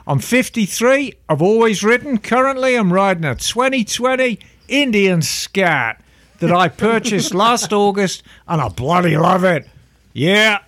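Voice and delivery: put-on reading a listener letter in an Australian accent. Reading Brent's letter aloud in an Australian accent. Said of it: "should I read this in the accent?".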